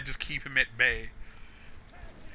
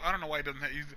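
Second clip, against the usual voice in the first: ordinary delivery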